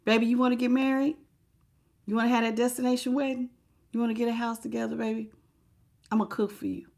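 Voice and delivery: soft, fast sweet talk; sweet-talking partner. Retold or imitated. Mimicking a partner selling a dream, soft and quick. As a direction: coax them with a hushed promise. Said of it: "sold you a dream of a happily ever after".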